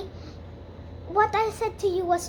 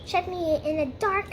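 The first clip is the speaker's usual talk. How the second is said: ordinary delivery, nothing marked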